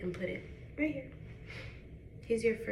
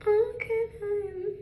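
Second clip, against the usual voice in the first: sudden high squeal; 12 semitones higher, 4 dB louder, slower than usual. Real, not acted. Breaking off her own sentence with a high squeal. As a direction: voice jumps way up, louder, caught off guard.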